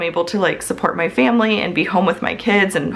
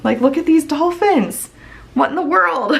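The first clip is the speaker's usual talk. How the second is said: higher, bright cooing over something cute; gushing delight; 8 semitones higher than usual. Real, not acted. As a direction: coo at the pictures like they are adorable. Gushing over cute planner scenes.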